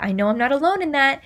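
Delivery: ordinary talk, nothing marked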